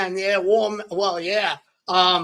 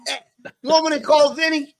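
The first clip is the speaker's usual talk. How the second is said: hammed-up East Coast wiseguy accent, pitched up; Joe Pesci; 2 semitones higher than usual. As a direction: play a mock mobster with a thick Rhode Island accent. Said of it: "you'll see Joe Pesci".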